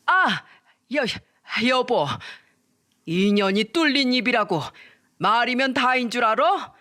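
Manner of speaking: a sudden high cry then an angry rebuke; shocked father shouting at his wife; father's outburst. Acted. Father explodes at mother.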